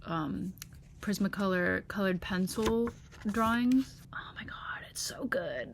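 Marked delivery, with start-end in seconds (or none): 4.1-5.7 breathless whisper, slowing on the last words; awed whisper. Real, not acted. Admiring her own childhood drawing, awed whisper. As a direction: marvel under your breath.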